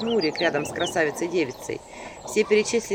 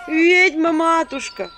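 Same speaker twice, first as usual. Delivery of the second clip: high, loud, slow wheedling call; loud sweet-talking cry; flattering call; 10 semitones higher, 8 dB louder, much slower than usual. A narrator's reading. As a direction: fawn on the witch through the door. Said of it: "знакомый льстивый голос".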